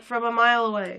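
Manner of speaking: ordinary delivery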